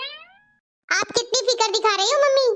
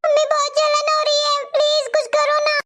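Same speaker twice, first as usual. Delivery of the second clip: higher, whiny pained pleading; whimpering in pain; 5 semitones higher than usual. Acted. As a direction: beg for help over a stinging burn. Complaining of burnt hand. (जले हाथ के दर्द से कराहती, रोनी आवाज़)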